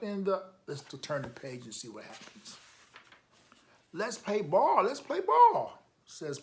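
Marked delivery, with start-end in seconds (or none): 3.9-5.8 rising higher and louder into a squeal; eager call. Acted. Pete begging his brother Bob to play ball, eager rising call. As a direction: beg to play, climbing up.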